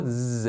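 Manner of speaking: "ز" buzzed in the throat; z sound. Shown as a demonstration. Teacher showing throat vibration, "ز" as a held buzz. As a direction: hold "ز" as a voiced "zzz". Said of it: "باعث لرزش گلوتون شد".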